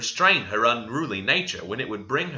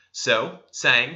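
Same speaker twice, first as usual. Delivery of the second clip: usual speaking voice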